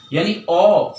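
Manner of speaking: ordinary talk, nothing marked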